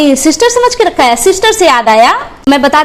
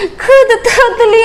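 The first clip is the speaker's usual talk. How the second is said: higher, slow, babyish lisping mockery; laughing mock baby-talk lisp; 8 semitones higher, slower than usual. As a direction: mimic a lisping child to ridicule her. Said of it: "खुद तोतली है".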